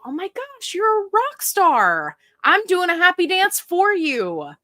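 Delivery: excited. Real, not acted. Cheering a viewer's weight loss.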